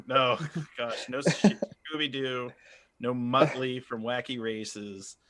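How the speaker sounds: usual speaking voice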